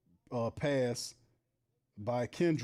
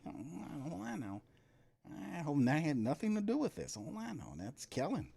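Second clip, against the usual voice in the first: stunned muttering; 2 semitones higher, 6 dB quieter than usual. Real, not acted. Stunned muttering while watching game.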